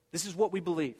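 ordinary talk, nothing marked

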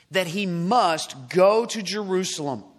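"must" drawn out and weighted; slow scripture reading, lean hard on "must"; stress on must. Shown as a demonstration. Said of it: "underline the word must".

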